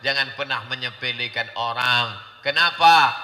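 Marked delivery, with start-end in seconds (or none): 2.5-3.1 raised, higher preacher's voice, Arabic drawn out; impassioned preaching. Real, not acted. Preacher telling a crowd God raises and lowers, impassioned. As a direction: preach it at full force.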